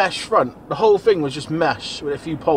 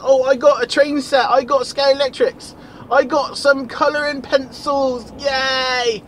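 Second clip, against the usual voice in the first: put-on excited kid voice; 7 semitones higher, slower than usual. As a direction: brag like a child at Christmas. Acting a kid bragging about Christmas.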